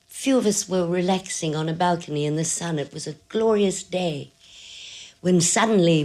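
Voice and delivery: ordinary talk, nothing marked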